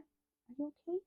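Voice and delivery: real soft concern for her dog. Real, not acted. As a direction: coo gently at a worried pet. Speaking to her old dog.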